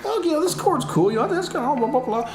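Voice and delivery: high, fast, laughing musing; mock inner voice. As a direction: play a giddy, fickle composer in your head. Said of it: "you're like".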